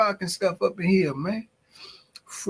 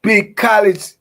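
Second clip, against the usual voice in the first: loud call of a nickname; 6 semitones higher, 11 dB louder, slower than usual. Real, not acted. Streamer calling out to Big College Jeff, loud. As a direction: shout the name across the room.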